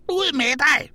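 very high, loud screech; skrigende. A narrator's reading. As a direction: scream it after someone who is leaving. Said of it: "skreg Eddike Sur efter ham". (skriger højt efter ham)

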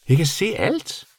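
high creature voice, rising at the end; surprised bat. A narrator's reading. Bat realising she can see, surprised high voice. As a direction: repeat it in high-pitched amazement. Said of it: "gentog Senior overrasket".

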